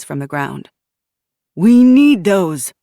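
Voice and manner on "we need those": slow, loud, heavy stranger's demand; a slow loud man's demand. Acted. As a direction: lean on each word, slowly. Armed stranger demanding weapons back.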